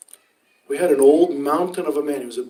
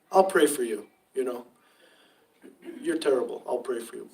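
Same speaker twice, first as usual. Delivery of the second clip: flat, offhand dismissal, trailing away softer; brush-off; 3 semitones lower, 6 dB quieter than usual. Pretend. Preacher mocking a dismissive promise to pray, softer and offhand. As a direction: say it as a polite brush-off. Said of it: "like a brush off".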